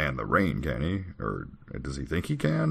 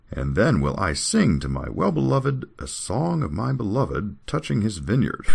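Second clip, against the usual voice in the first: slow, higher, lilting recitation; scripture reading; 5 semitones higher than usual. Acted. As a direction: declaim it like a love psalm. Reads scripture aloud.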